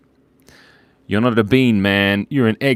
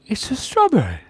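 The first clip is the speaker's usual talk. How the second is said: squeaky strawberry voice; 13 semitones higher than usual. Pretend. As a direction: pitch it way up like a cartoon fruit. Playful voice for the drawing.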